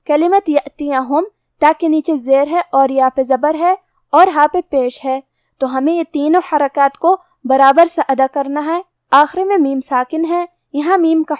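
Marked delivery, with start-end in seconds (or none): none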